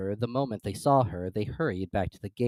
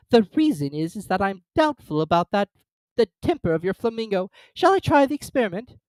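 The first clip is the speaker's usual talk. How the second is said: put-on high, loud fussy old duchess; 10 semitones higher, 6 dB louder than usual. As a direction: prattle like a silly duchess. Duchess character voice.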